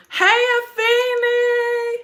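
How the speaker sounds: high, drawn-out singsong call-out; gleeful sung hello to a friend; singsong greeting. Real, not acted. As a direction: stretch the name out high and loud, singing it.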